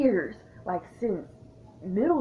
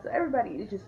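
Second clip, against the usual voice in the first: ordinary delivery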